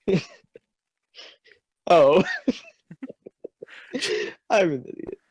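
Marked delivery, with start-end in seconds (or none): none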